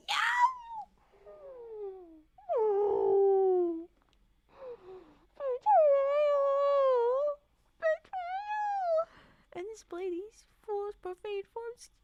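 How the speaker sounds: shrieking cry of betrayal; betrayal scream. Acted. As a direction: wail the betrayal at full force. Character cries betrayal.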